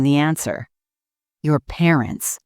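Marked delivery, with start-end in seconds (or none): none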